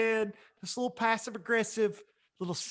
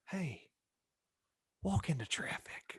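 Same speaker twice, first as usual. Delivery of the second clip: soft sweet insult; 11 dB quieter, slower than usual. Shown as a demonstration. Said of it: "very much like".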